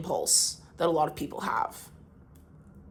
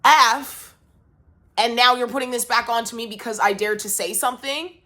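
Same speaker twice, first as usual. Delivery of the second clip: upset venting; 3 semitones higher than usual. Real, not acted. Upset rant at someone who hurt her.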